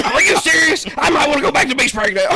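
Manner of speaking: high, loud retold outrage through laughter; mock indignation. Storyteller quotes her reaction to a burial remark, mock indignant. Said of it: "she got mad then".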